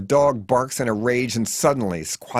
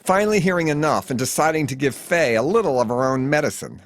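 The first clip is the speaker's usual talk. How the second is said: plain reading voice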